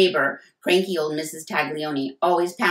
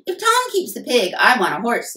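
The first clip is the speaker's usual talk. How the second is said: much higher and louder bratty bargaining; higher, louder whiny sister voice; demanding sister; 7 semitones higher, 7 dB louder than usual. A narrator's reading. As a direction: insist like a teen who wants her fair share. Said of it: "said Sarah".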